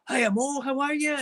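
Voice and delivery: very high sing-song cheery greeting; sudden friendliness. Retold or imitated. Raging man greets his neighbour, suddenly sweet and high. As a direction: imitate an abrupt switch to chirpy politeness. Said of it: "his tone totally changed".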